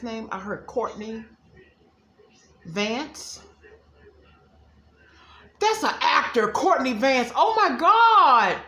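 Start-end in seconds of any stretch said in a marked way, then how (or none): 5.6-8.6 high, loud, slow oh my God; real gasping surprise, stretched out; loud stunned exclamation; shocked. Real, not acted. Shocked at a name.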